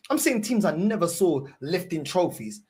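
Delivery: ordinary talk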